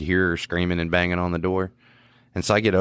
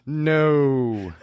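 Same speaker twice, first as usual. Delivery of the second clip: appalled refusal; 6 semitones higher than usual. Retold or imitated. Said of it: "I was like".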